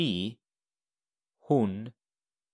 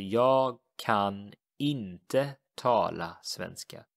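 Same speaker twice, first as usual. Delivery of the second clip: jag kan inte tala svenska, slow repeat; slow model sentence; slower than usual. Shown as a demonstration. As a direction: say it again slowly. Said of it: "I cannot speak Swedish".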